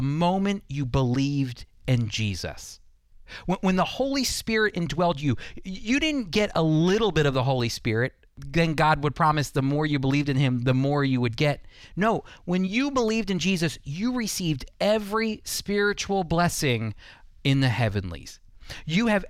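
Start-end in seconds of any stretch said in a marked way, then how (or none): none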